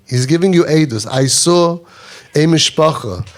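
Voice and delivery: usual speaking voice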